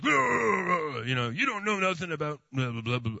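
lightly hammed-up angry blah-blah rant; mock the angry contractor, half-hearted. Preacher mocks an angry reply to the homeowner, hammed-up rant. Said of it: "not anger".

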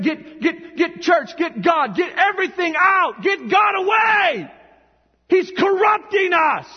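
high, loud mock-outraged shout; exaggerated angry shouting. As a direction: build to a frantic, drawn-out yell of rejection. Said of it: "They don't say it like that".

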